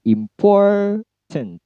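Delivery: Important, POR high and held long; careful demo of word stress on Important. Shown as a demonstration. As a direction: say "Important" slowly with POR lifted. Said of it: "Pour-nya yang tinggi, im-nya rendah".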